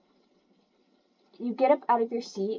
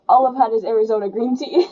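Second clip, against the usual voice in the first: loud, fast confession; exclaiming; 8 dB louder, faster than usual. Real, not acted. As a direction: blurt it out with a laugh.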